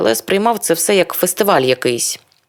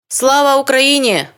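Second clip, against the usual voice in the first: shout; 9 semitones higher than usual. A narrator's reading. Stranger shouting at the metro. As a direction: call it out to the crowd. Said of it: "кричить незнайомий".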